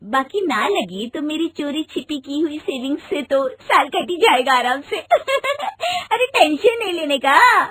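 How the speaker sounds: light giggly reassurance; wife giggling at husband's resignation news; giggling carelessly. Acted. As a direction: laugh it off cheerfully. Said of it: "तुम खिलखिलाकर हंस रही हो".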